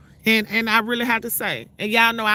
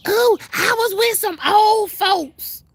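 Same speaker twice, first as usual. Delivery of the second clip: much higher, louder, slow prissy mock; mocking the neighbor's excuse about her Vegas trip, much higher and slow; 11 semitones higher, 5 dB louder, slower than usual. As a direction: parrot her lame excuse in a high sing-song.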